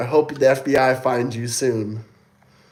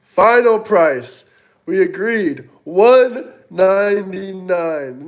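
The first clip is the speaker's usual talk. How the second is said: final price; 5 semitones higher, 4 dB louder, slower than usual. Acted. Haggling, dragged out price.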